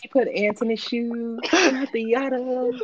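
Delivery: high, slow mock video voice. Friends quoting a viral video, high and slow. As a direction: squeak it out like a meme clip.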